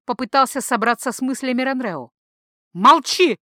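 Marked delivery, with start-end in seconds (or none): 2.7-3.4 a loud, sharp cry of "Молчи!"; frantic shouted command; sudden cry. Acted. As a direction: cry out to silence him. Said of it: "вдруг вскрикнула женщина".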